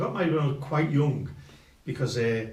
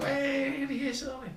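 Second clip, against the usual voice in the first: imitated party cheer. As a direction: call out like guests greeting the groom. Party guests greet the groom.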